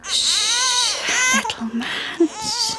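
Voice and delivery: put-on high, gentle shushing to a baby; soothing hush. Mother hushing her crying baby, tender and soothing. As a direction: hush the little one tenderly.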